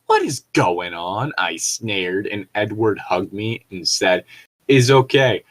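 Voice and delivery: normal talking voice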